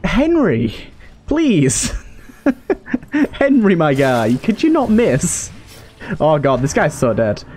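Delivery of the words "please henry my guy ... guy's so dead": usual speaking voice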